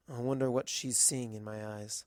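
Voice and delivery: usual speaking voice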